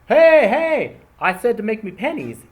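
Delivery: high, loud mock outburst; hammed-up angry shout; mock angry boss. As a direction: act out the boss blowing up over the pennies. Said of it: "And I'm all mad".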